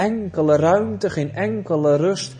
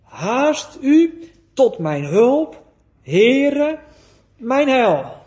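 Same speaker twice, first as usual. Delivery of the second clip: slow, loud cry for help to the Lord; 4 semitones higher, 4 dB louder, much slower than usual. Acted. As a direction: call out slowly, pleading, louder. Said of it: "Dan gaat hij roepen". (luid en langzaam roepen om hulp)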